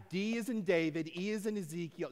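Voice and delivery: high, insistent spelling for a bad listener; exasperated spelling-out. Spelling out misheard word.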